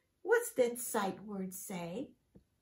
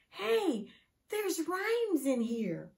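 ordinary delivery

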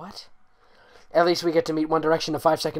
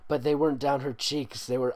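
ordinary delivery

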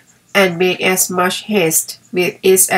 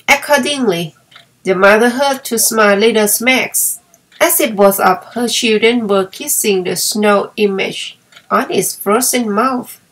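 usual speaking voice

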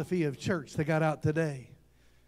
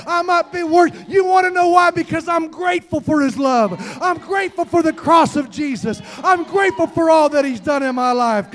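loud, high, impassioned testimony; full-force preaching; 8 semitones higher, 11 dB louder than usual. Real, not acted. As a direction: belt out your gratitude to the congregation.

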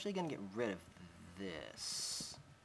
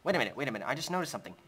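ordinary talk, nothing marked

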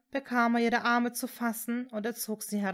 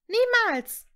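high, loud cry of refusal; shouted; 12 semitones higher, 10 dB louder than usual. A narrator's reading. Hermine refuses Severus and Voldemort, shouting. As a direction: yell it out, voice leaping up. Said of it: "und rief".

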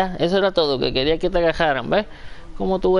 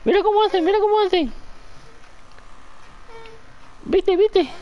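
habla de bebé. Real, not acted. High baby talk. (vocecita aguda para el bebé)

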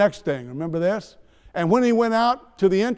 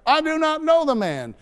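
much higher, swearing he never knew him; cursing denial; 8 semitones higher than usual. Acted. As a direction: cry it out high, a cornered man swearing. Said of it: "to invoke a curse on himself and to swear".

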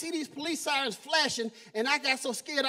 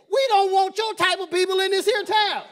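put-on louder, higher good old boy cop; 6 semitones higher, 8 dB louder than usual. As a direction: growl it like a small-town sheriff. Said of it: "'Cause he literally said".

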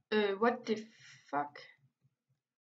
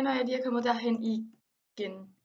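ordinary delivery

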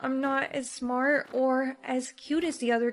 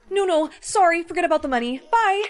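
panicked; 4 semitones higher, 7 dB louder than usual. Acted. Bully scared off.

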